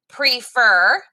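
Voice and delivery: "prefer" with a long E, "pree-fer", rising; pronunciation. Shown as a demonstration. Teacher models "prefer" with a long E. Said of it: "if you say it like me".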